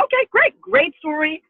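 high, loud and upbeat; retold cheery sign-off; breezy approval. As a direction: toss it off as a pleased quick reaction. Retold upbeat reaction to her story.

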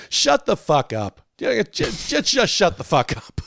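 drawn-out, stammered mock scolding. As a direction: imitate a singer snapping at an audience member. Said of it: "basically go".

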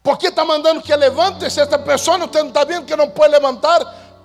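high loud scolding shout; angry nurse shouting. Retold or imitated. Nurse scolding the young preacher, high angry shout. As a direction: chase him out, yelling. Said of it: "la enfermera".

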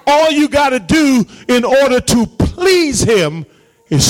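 high, drawn-out preaching cry; impassioned preaching. Real, not acted. Pastor urging the congregation to step out on faith, impassioned. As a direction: preach it out with long-held stresses.

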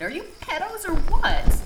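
high, loud accusing shout; angry yell. Acted. Ebony accusing the staring professors, angry yell. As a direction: yell it in their faces.

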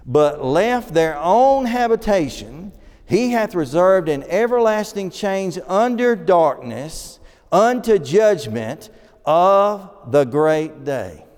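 slow, emphatic preacher's reading; scripture reading. Acted. Preacher reading the verse on fallen angels to the congregation, slow and declamatory.

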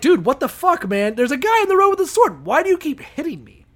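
high, loud, mock-hysterical outburst; hammed-up shrill complaint, rushing in the middle; shrieking donkey. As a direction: shriek in protest as a panicked donkey. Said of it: "the donkey starts shrieking like".

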